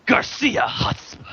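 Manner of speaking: loud, high, drawn-out name; dramatic character announcement. Acted. Friend supplying the hero's forgotten name, theatrical loud proclamation. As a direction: proclaim it with slow, hammy grandeur.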